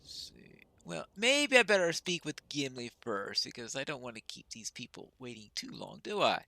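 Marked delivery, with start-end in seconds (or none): none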